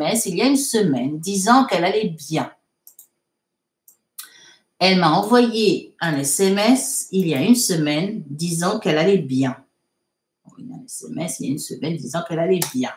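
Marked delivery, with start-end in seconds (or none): 4.8-9.6 measured, phrase-by-phrase reading. Shown as a demonstration. Teacher repeating a sentence for students to translate, measured dictation. 10.5-12.9 quick, low, softened run-through; reading to oneself. Real, not acted. Teacher rereading the sentence to herself, quick and quiet.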